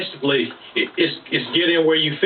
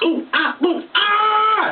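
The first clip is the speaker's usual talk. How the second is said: much higher falsetto ooh-ah cries; much slower than usual. Retold or imitated.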